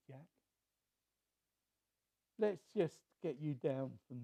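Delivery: quiet, steady, reassuring; soft calming divine voice; calm reassuring God. Retold or imitated. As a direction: settle him down gently. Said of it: "said God".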